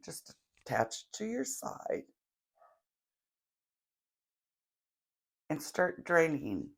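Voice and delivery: calm guiding voice for a visualization; guided meditation. Acted. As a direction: lead them gently through the imagery. Guided visualization, draining energy.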